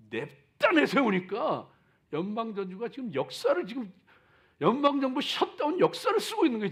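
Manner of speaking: loud, rising sermon build; booming motivational speech. Acted. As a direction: hammer the point home. Sermon crescendo.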